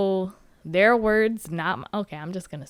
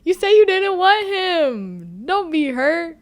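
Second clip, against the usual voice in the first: higher, louder exasperated plea; pleading with Irene; 7 semitones higher, 5 dB louder than usual. Retold or imitated. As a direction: urge her, come on. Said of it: "looking at Irene like".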